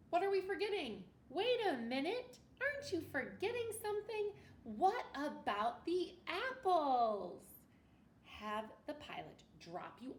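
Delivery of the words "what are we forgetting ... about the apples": higher, louder, over-the-top surprise; mock alarm. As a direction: play it big for the kids, like you forgot the apples. Reader acts shock at forgetting.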